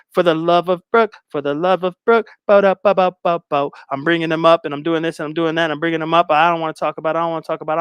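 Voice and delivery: fast run-on mock chatter; babbling and rambling. Guest rambling on about her divorce, fast mocking babble. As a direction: rattle it off without stopping, repeating yourself. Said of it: "she keeps babbling and babbling".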